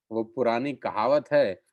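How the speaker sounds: ordinary talk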